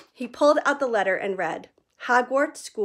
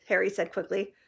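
ordinary talk, nothing marked

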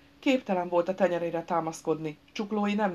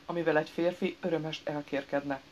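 usual speaking voice

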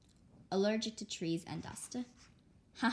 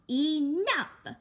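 loud, high, sharp command; stern shout; 8 semitones higher, 8 dB louder than usual. Acted. Mother stops the kids arguing over fast food, loud. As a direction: cut them off.

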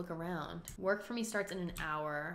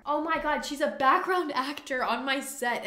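normal talking voice

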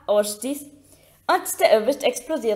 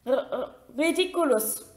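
stammered squeak; 3 dB quieter than usual. A narrator's reading. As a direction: stumble into the word in a small squeak. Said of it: "quiekte Neville". (stotterndes, leises Quieken)

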